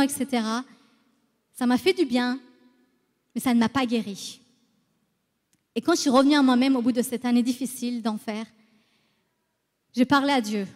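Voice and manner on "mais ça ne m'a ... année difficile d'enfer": ordinary talk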